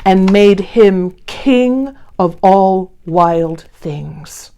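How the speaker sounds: ordinary delivery, nothing marked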